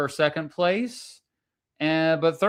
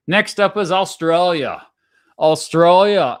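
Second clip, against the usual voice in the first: loud, higher-pitched mock Australian accent; attempted Australian accent; 5 semitones higher, 8 dB louder than usual. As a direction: have a playful go at an Australian accent, badly. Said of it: "I can't do an Australian accent".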